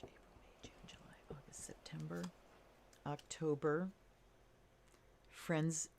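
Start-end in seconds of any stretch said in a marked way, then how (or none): 0.0-2.2 unvoiced whisper. Real, not acted. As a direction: breathe the words without voice. Months repeated under breath.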